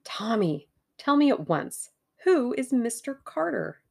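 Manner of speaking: high, insistent questioning; urgent demand. A narrator's reading. Tuppence pressing Tommy about Mister Carter, urgent. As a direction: press for the answer, pitch lifted.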